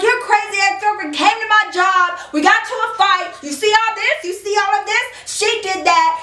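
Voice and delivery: high, agitated accusation; worked-up rant. Retold or imitated. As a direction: re-enact venting at a partner just woken. Angrily telling partner about fight.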